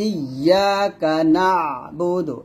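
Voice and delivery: নাবুদু with a squeezed throat sound after NA; slow recitation, doubled Y in ইয়াকা and pressed নাবুদু; pressed ayn. Shown as a demonstration. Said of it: "আইনটা একটু চাপ দিবেন".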